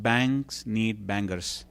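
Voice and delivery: slow quote. Shown as a demonstration. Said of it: "paraphrase what he said".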